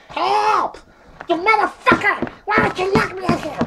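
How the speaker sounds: high, loud cry for help; locked-in yelling. Acted. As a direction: shout at him from inside the box. Penguin locked in box yelling.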